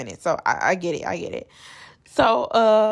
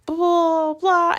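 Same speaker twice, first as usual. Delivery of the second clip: high, louder mocking babble; high mock blah; 9 semitones higher, 4 dB louder, slower than usual. Vlogger mocks Bria's rant, high and loud. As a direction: wave it off in her high mock voice. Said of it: "she's like".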